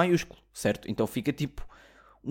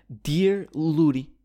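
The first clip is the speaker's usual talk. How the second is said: slow, stretched mock English reading of the name; mock email greeting; 3 semitones higher, 3 dB louder, much slower than usual. Mocking the foreign shop's email, slow and stretched. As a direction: drag out the wrong name like a clueless clerk.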